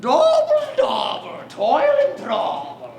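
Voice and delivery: put-on loud, hammy Scottish accent, slow on toil and trouble; Scottish accent, cackling witch chant; mock Scottish witch. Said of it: "in perfect Scottish accent".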